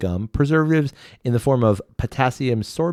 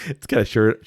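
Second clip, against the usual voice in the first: ordinary talk, nothing marked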